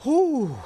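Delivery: loud, high whooped exhale; relieved whew. Real, not acted. Host trying to chill out, big relieved whew. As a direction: blow off steam with a big whew. Cooling off after outburst.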